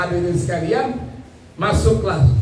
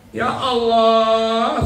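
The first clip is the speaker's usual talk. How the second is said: drawn-out lament; 3 semitones higher, much slower than usual. Acted. Sister groans at new demand.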